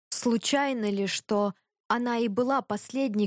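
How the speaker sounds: ordinary delivery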